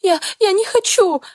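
high sobbing protest. Acted. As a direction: cry out through sobs. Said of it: "Всхлипываю".

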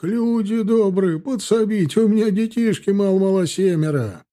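high, plaintive plea; pleading. A narrator's reading. Peasant begging passers-by for help, plaintive pleading. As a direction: beg the crowd for help.

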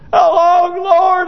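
high, loud plea; impassioned cry sent up to heaven. Acted. Saints under the altar crying to the Lord, loud plea.

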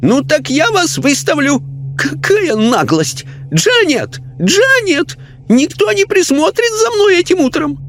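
high, outraged lady's voice; indignant lady. A narrator's reading. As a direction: scold the intruder. Lady spirit speaks.

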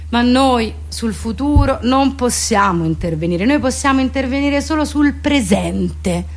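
normal talking voice

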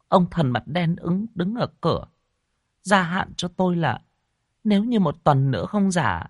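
high, shaken wife's voice; frightened wife. A narrator's reading. Wife tells husband she dreamed it too, frightened.

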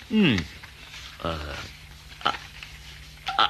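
ordinary talk

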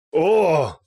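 loud, high drawn-out "oh"; battle cry. A narrator's reading. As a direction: cry out as the attack hits. Крик во время атаки. (громкий высокий вскрик)

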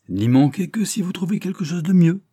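high, mocking jest. A narrator's reading. Louis teasing Lilia at the ball, mocking. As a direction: toss it off as a teasing jab. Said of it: "d'un air gouailleur".

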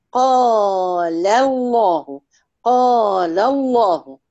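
الله in قال الله with a full heavy lam, said slowly; slow قال الله, making the lam of الله heavy; heavy lam in Allah. Shown as a demonstration. Teacher demonstrates a heavy lam after a fatha. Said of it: "مفخمة اهي".

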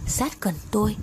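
ordinary delivery, nothing marked

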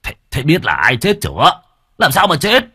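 urgent half-shouted questions, pitch rising; near shout. A narrator's reading. Village chief demanding news, nearly shouting. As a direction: uncle blurting out in alarm. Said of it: "Bác Tân nói như hét".

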